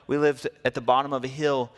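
normal talking voice

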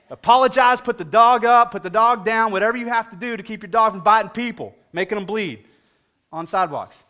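loud, emphatic sermon punch; preaching. Acted. Preacher telling dog owners what to do, loud and emphatic. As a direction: hammer each command home.

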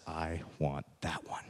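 put-on quiet firm boy. Boy pointing at the crippled dog, quietly insistent. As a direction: say it low and certain, barely above a breath.

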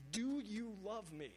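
slow, high, heartfelt "Do you love me"; emphatic plea. Acted. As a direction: draw out DO YOU high, then fall. Preacher voices Jesus' heartfelt climactic question.

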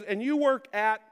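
a hint of a nurse checking details, voice rising; nurse's changing voice. Nurse reads the patient's details, voice changing. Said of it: "And her voice changes".

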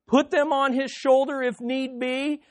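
ordinary delivery

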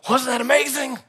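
high, lightly voiced starry-eyed mentor; mock thrilled Mike. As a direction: imitate his oblivious delight.

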